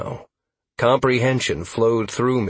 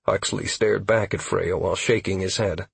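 ordinary delivery

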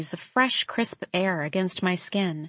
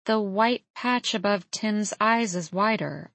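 ordinary delivery, nothing marked

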